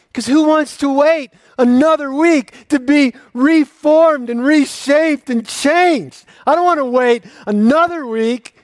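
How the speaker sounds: loud, high, ringing sermon voice; full-force preaching. Acted. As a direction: preach it out to the whole church.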